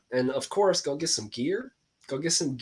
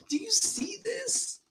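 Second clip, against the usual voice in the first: high, slow whisper of amazement; awed whisper; 7 dB quieter, slower than usual. Retold or imitated. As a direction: breathe the words as if in awe. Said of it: "I'm like".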